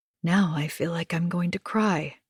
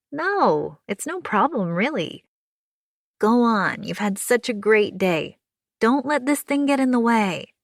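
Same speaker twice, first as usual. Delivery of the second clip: higher, lively neighbour calming her friend; reassuring June; 5 semitones higher than usual. Acted. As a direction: kind neighbour, upbeat comfort. June insists it's no trouble.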